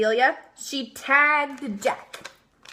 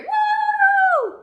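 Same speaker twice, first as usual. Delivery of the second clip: cheering whoop; 4 dB louder than usual. Acted. Team cheers.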